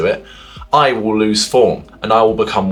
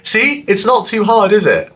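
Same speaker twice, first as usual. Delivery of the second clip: high, bright and louder, forcing joy; forced happiness; 11 semitones higher, 3 dB louder than usual. Shown as a demonstration. Vlogger showing viewers a forced happy mood, beaming. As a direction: switch on happiness on the spot. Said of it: "try it right now. I will".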